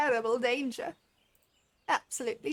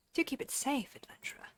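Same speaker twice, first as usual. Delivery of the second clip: a soft, breathy whisper to the adventurer; whispered confidentially; whispered aside; 3 semitones higher, 7 dB quieter than usual. Acted. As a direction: hush it like a secret. Reading game character's parting line.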